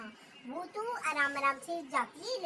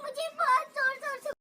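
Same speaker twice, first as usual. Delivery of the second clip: put-on crying child; 11 semitones higher, 8 dB louder than usual. Crying girl answers aunt.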